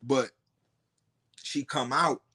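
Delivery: ordinary delivery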